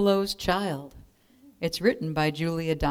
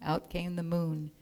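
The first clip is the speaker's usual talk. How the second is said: ordinary delivery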